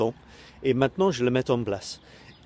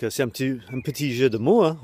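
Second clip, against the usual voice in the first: ordinary talk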